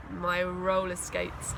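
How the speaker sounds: plain reading voice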